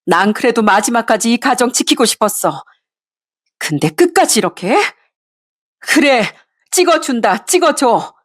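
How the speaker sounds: wife venting fury at her husband; bitter outburst. Acted. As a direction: pour out years of resentment, rising to the end. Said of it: "제가 울분을 쏟아내던".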